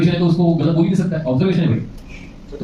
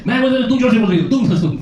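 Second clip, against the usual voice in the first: much higher, louder snapping back; heated retort; 9 semitones higher, 5 dB louder, faster than usual. Retold or imitated. Couple's quarrel acted out, snapping back at his wife loudly. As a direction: shout back over her.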